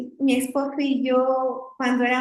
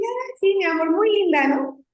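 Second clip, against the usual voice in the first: sarcastic sweetness; 6 semitones higher than usual. Retold or imitated. Mock-sweet reply to husband.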